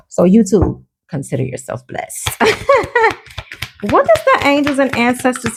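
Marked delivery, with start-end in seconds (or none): 1.1-2.3 playful whisper. Acted. Teasing aside to YouTube viewers. 2.3-3.1 a quick high-pitched giggle; short bright giggle, breaking off. Real, not acted. Laughs after teasing TikTok.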